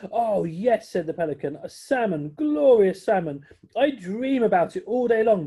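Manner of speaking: loud, high, rapturous over salmon; longing pelican. Acted. Pelican dreams aloud of salmon to friends, rapturous.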